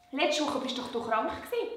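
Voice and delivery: usual speaking voice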